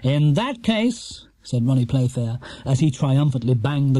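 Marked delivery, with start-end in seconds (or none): 0.0-1.3 smug quizmaster. A narrator's reading. Quizmaster Ronnie.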